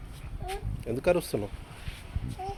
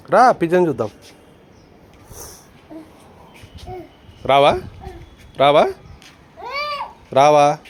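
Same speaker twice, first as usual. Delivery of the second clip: beckoning, well above talking volume; calling out; 5 semitones higher, 10 dB louder, slower than usual. Real, not acted. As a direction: call the little one over, loudly. Calling the child to come.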